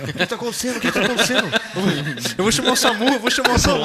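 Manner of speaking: hammed-up panicking girlfriend, high and loud; mock frantic cries through laughter; desperate. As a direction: imitate someone frantically calling for help. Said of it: "ela tava assim, desesperada".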